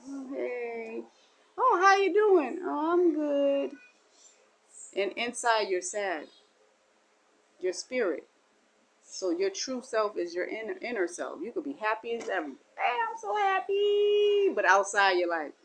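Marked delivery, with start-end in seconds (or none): 0.1-3.7 high, sing-song put-on brightness, cracking on "I'm good"; hollow cheerful small talk; fake cheerful greeting. Fake cheer hiding sadness. 12.5-14.5 much higher, slow, sugary "so happy"; overdone happy front; fake happy. Showing a fake happy face.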